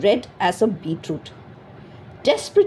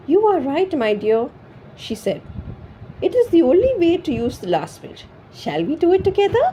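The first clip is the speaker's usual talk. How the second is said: tearful agreement; 8 semitones higher than usual. A narrator's reading. Wife smiling through tears.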